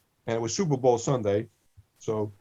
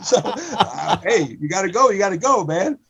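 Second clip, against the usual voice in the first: words broken by laughter, higher and louder; laughing through words; 7 semitones higher, 6 dB louder than usual. Real, not acted. Laughing while repeating the deli line. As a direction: still laughing as he talks.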